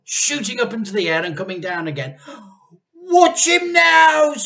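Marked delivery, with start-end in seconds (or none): 2.9-4.3 very high, loud, slow shout. A narrator's reading. Mr Cranky urging everyone to watch, loud shout. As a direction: yell with wild excitement. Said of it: "cried Mister Cranky".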